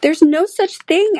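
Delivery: high, loud, slow objection; indignant high-pitched contradiction; angry. Acted. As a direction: object crossly, stretching the words. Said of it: "beginning to get very angry".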